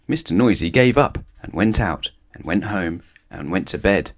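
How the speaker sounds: ordinary delivery, nothing marked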